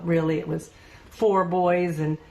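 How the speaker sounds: normal talking voice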